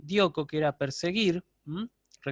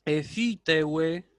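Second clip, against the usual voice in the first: pronunciación lenta; much slower than usual. Shown as a demonstration. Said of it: "sería Jolukianos".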